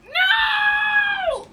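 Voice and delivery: sharp high shout of protest. Real, not acted. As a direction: yell it in alarm. Enemy keeps hitting him.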